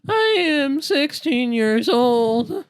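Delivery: put-on high, slow, drawn-out kid voice; teasing the friend in a high child voice; squeaky kid voice. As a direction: stretch it out like a little kid. Joins the squeaky kid bit.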